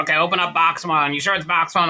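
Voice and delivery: smoker mother's voice. Retold or imitated. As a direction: imitate mom giving orders over speakerphone. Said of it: "I always wanna do her voice".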